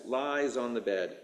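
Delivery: usual speaking voice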